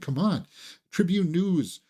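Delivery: ordinary delivery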